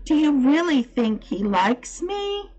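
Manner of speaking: high, hopeful question; eager. A narrator's reading. Mary wanting to know if the robin likes her, eager. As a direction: ask as if you so want to know. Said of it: "cried Mary eagerly. She did so want to know".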